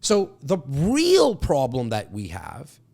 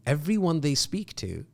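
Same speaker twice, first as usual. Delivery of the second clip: ordinary talk, nothing marked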